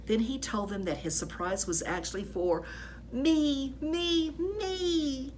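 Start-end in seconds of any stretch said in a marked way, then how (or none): none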